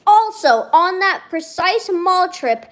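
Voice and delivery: high, loud, drawn-out delivery; worked-up emphasis. Real, not acted. Streamer recalling the plush they whined about, worked up. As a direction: announce it slowly and forcefully, pitch up.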